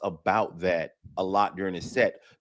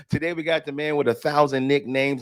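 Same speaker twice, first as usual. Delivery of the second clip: ordinary talk, nothing marked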